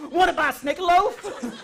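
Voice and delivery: loud, high, laughing yell; shouted. Retold or imitated. Comedian shouting at a stranger in the airport, loud high yell. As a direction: bellow it at a stranger. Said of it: "what do I shout at the stranger's face".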